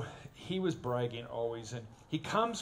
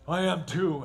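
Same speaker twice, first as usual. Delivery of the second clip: put-on louder, defiant kid; mock defiant shout; 5 semitones higher, 9 dB louder than usual. Little brother defying the narrator, mock shout.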